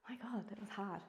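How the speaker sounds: whispered aside to herself; exhausted whispered gasp of relief; breathless whisper. Real, not acted. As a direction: whisper it, spent after the set. Worn-out aside after hard set.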